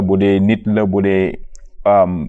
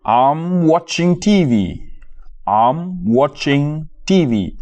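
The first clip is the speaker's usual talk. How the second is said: slow model phrase; 4 semitones higher, much slower than usual. Shown as a demonstration. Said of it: "teacher models the phrase".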